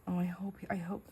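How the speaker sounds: hushed hopeful whisper; real whisper. Real, not acted. Hoping the noise upstairs stays quiet, whispering. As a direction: whisper so no one upstairs hears. Husband home, keeping quiet.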